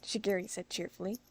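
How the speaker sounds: ordinary delivery, nothing marked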